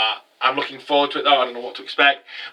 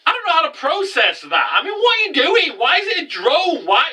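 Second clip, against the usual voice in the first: high-pitched, incredulous rant; baffled, pitch soaring on every question; exasperated disbelief; 14 semitones higher than usual. Real, not acted. Reviewer baffled by the drone twist, exasperated and high.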